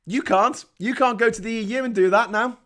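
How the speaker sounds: ordinary talk, nothing marked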